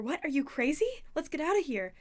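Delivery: high, loud disbelief; panicky protest. A narrator's reading. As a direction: urge an escape in alarm. Percy balks at beheading plan.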